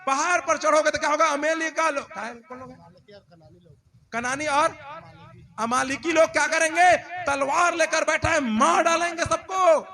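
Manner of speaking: high, very loud prophet's alarm; shouted warning. Acted. Moses warning people off the mountain, shouted warning. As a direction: shout to stop a crowd heading into danger. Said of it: "मूसा चिल्ला चिल्ला कर बोल रहा है".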